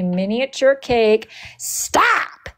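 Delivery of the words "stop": a sudden squeaky high Stop! cutting in; sharp high stop. Real, not acted. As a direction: yelp Stop! at yourself. Cuts herself off mid-story.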